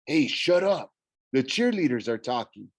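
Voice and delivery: usual speaking voice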